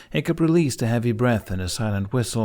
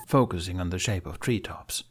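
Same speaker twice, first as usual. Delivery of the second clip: ordinary delivery, nothing marked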